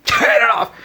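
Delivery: extremely high, loud cry; shrieked protest. Retold or imitated. Mum shrieking at her son to turn the song off. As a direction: shriek to stop it.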